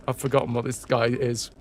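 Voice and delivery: plain reading voice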